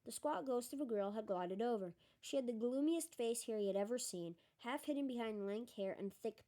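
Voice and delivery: ordinary delivery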